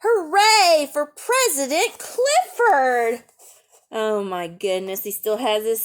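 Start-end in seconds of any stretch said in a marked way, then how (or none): 0.0-3.2 higher, louder, slow cheer. A narrator's reading. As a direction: cheer it out for the winner. Cheer read from the book.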